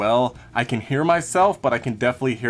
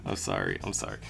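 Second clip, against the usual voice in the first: much lower, softer murmur; quiet apology; 10 dB quieter, faster than usual. Real, not acted. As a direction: mumble an apology under your breath. Apologizing softly off mic.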